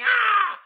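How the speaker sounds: high, loud mock yell; imitated yell. Host mimics the housewife on the sign, high mock yell. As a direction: yelp like a yelling reality-show housewife. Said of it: "yelling like".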